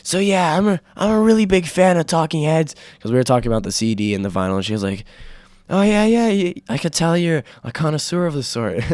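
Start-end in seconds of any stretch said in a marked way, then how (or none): 0.0-2.8 mock loud shouting. Shouting to a friend over loud bar music. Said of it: "we had to talk really loud". 5.7-8.9 lightly mock the friend's praise; teasing high voice. Friend teasing the speaker about Talking Heads, mock-impressed.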